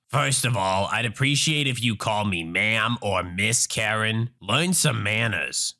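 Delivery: low, slow haughty Karen voice; entitled mother. Acted. Entitled mother demands respect from a stranger, haughty and low. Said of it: "Entitled mother looks shocked".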